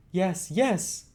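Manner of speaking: put-on high boyish voice, eager; eager Oliver. Oliver answering Rose, high and eager. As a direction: jump in keenly as the boy.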